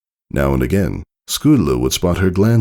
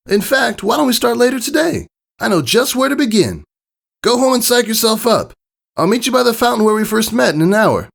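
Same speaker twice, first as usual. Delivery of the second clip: much higher, brash pony voice, quickening at the end; cocky coach setting a meeting; eager high pony voice rushing the plan; confident Rainbow Dash. Acted. Narrator voices Rainbow Dash planning.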